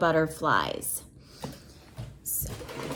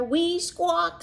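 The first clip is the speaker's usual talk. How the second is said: put-on baby parrots, pitched higher; a hint of little parrots, higher; 7 semitones higher than usual. Reading to a child, baby parrots squawk higher. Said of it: "said the three".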